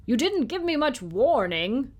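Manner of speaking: the small knight shouting up at William, reproachful. A narrator's reading. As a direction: shout up from far below as a tiny knight. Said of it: "he shouted up at William".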